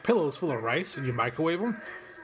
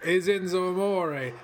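Enzo impression; 4 dB quieter, slower than usual. Imitating Enzo cutting his promo. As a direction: do his catchphrase slow and cool. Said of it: "I'm about to do his whole shtick".

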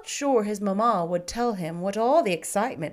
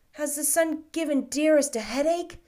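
anxious; 6 semitones higher, 4 dB louder than usual. A narrator's reading. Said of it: "he inquired anxiously".

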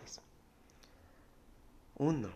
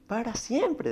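much higher, louder cry on siempre; emphatic cry; 13 semitones higher, 7 dB louder, slower than usual. Acted. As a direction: shout the promise out. Climax of sermon phrase. (grito enfático: para siempre)

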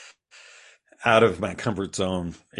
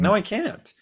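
much higher, giggly mock refusal; laughing protest; 13 semitones higher than usual. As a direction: laugh it off, half-joking no. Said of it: "I'm like".

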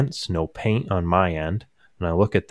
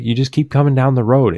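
louder, raised-pitch imitation of an exasperated dad; mildly frustrated; 6 semitones higher, 7 dB louder, faster than usual. Dad directing grandma down the road, imitated frustration. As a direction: insist like a dad losing patience. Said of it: "he's getting a little frustrated".

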